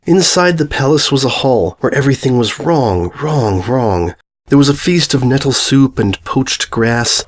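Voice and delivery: plain reading voice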